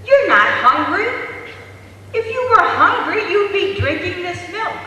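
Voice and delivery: high, loud annoyed mother; acted exasperated mother telling off; cross scolding. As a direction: scold the baby impatiently.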